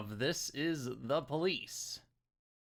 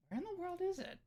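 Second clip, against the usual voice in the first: high voice; 8 dB quieter, faster than usual. Real, not acted. Searching menu, odd high voice.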